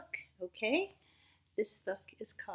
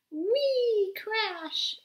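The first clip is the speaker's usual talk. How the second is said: much higher, louder sound-effect cry; excited whee; 13 semitones higher, 8 dB louder than usual. Acted. Teacher voicing the slide crash for children, excited. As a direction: squeal it like a slide ride.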